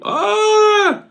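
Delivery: short startled high cry; sudden high yelp. Real, not acted. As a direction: let out a sharp high yelp. Reacts to chat comment.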